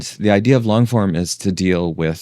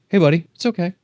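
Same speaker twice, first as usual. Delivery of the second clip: much higher, sing-song coaxing to the dog; high dog-talk voice; 12 semitones higher, much faster than usual. Real, not acted. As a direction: soothe a nervous puppy up high. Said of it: "You didn't know I could go that high pitch".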